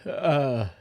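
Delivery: stretched out in a yawn. Real, not acted. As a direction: yawn through the word. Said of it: "I did not mean to yawn into the mic".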